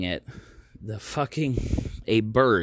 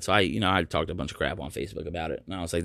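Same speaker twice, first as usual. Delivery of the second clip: normal talking voice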